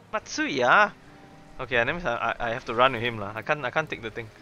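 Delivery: usual speaking voice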